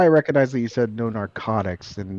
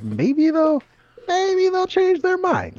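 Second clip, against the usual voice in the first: mocking high voice; 3 dB louder, much slower than usual. Mocking hopeful people.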